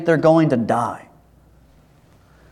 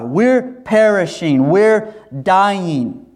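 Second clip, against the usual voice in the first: mild panicked cry; 5 semitones higher, much slower than usual. Acted. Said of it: "they think they're going to die".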